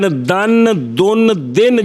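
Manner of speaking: دن said three ways: dan, dun, din; change only the vowel in each دن: a, u, i; دن as "dan", "dun", "din" in turn; contrasting short vowels. Shown as a demonstration. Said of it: "یہ جو فرق پڑ رہا ہے".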